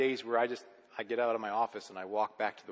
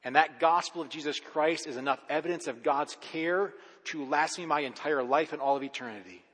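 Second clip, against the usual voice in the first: usual speaking voice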